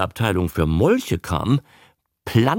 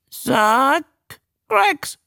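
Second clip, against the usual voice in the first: very high squawk, word by word; high creature mimic with pauses; newt repeating. A narrator's reading. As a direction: parrot it back in newt voice. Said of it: "wiederholte der Molch".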